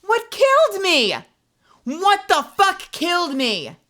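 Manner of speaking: high, loud, baffled demand; can't believe what just happened; indignant cry at the screen; outraged disbelief. Real, not acted. Confused by sudden death.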